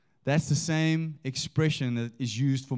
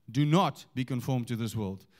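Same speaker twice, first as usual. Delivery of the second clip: "not" punched, the words after it dropping lower; stress on NOT; 4 semitones lower than usual. Shown as a demonstration. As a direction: hit the word "not" hard. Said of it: "change the emphasis on the words".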